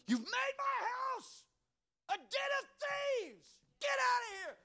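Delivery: very high, slow screamed rebuke; furious high shout driving out the thieves; screaming Jesus. Retold or imitated. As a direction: scream it like Jesus throwing them out. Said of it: "He's screaming".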